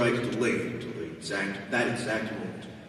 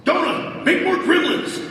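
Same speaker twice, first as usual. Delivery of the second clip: high, loud commanding shout; 6 dB louder than usual. Acted. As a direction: bark the order across the fight. Shouting orders in battle.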